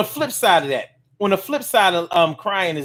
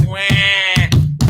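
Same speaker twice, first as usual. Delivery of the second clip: mock baby wail; 3 dB louder than usual.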